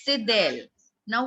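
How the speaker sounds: usual speaking voice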